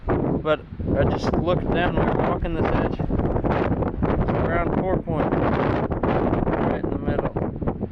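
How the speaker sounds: shouting over wind. Real, not acted. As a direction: call out loud over the gusts. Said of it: "I don't know if you can hear me over the wind".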